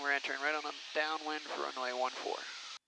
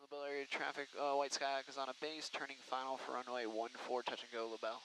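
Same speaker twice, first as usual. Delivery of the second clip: radio call; 7 dB quieter than usual. Shown as a demonstration. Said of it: "instructor makes radio call".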